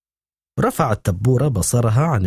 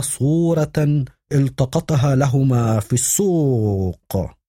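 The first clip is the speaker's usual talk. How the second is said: higher, slow, measured woman's voice; Esther on the phone; 6 semitones higher, slower than usual. A narrator's reading. Esther calls Victor's office demanding news, slow and precise. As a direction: speak slowly and precisely, like a stern aunt.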